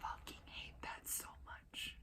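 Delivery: slow, very quiet whisper of loathing; drawn-out whispered exasperation; disgusted whisper. Real, not acted. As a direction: whisper it slowly, seething with irritation. Venting disgust at the affected pronunciation.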